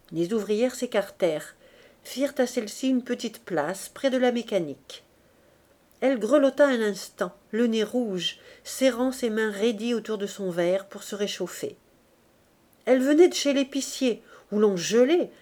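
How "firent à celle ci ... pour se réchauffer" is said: usual speaking voice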